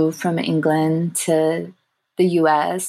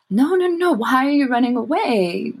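high, rising no no no, lightly voiced grandfather; a hint of a grandfather chiding his daughter, pitched up; grandfather's gentle protest; 7 semitones higher than usual. Grandfather telling his daughter not to flee the dead, high lightly voiced protest. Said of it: "And Papa had told her".

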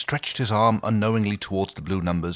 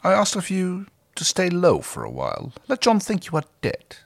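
lightly voiced villain, pitched high; measured command; 12 semitones higher than usual. Scorpius tells Harvey to hide, measured command. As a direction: give deliberate instructions in a raised voice.

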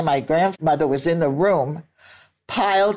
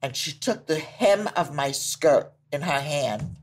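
usual speaking voice

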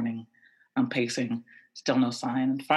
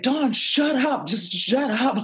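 high-pitched, drawn-out telling-off; exasperated, high-voiced scolding; urgent hushing; 11 semitones higher, 3 dB louder, slower than usual. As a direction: cut off your friend's outburst, pitch way up. Retold friend frantically silencing her.

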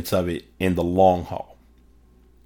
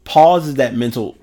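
usual speaking voice

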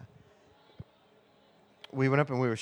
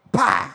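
mock gunshot; 8 dB louder than usual. Imitating gunshot.

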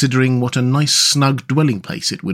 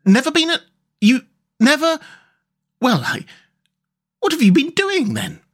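very high, faltering disbelief; astonished; 14 semitones higher than usual. A narrator's reading. Rat questioning boatless Mole, astonished and high-pitched. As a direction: stammer in broken phrases, utterly amazed. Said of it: "open-mouthed".

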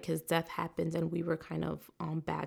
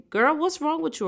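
loud, higher scolding grown-up; scolding elder; 9 semitones higher, 6 dB louder than usual. Retold or imitated. Elder scolding a child, loud and sharp. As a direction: snap at a girl like a scolding elder. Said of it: "do you hear someone say".

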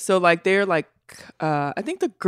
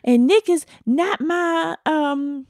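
higher, mock-indignant quoted mom; imitating a touchy mom defending her family; lightly mimicked upset mother; defensive mom; 11 semitones higher than usual. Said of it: "really defensive and, like, upset".

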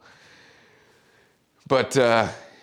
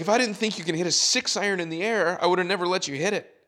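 retold screaming; 9 semitones higher, faster than usual. Caddy retelling his blow-up at the player, high scolding. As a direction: reenact an angry telling-off. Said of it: "I was screaming at".

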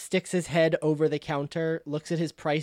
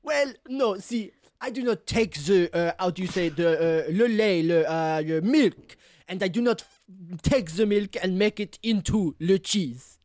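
hesitant Franglais, groping for words; French accent; 3 semitones higher than usual. Acted. Cheese seller explaining his trade, French accent. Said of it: "The Fringlish is so good".

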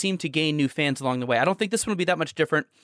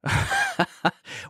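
amused laugh. Real, not acted. Amused by work-from-home tip question.

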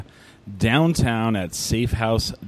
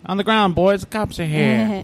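pitched-up mock police order; raiding cop; 7 semitones higher than usual. Host jokes about cops raiding the still, mock police order. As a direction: play a cop bursting in on moonshiners.

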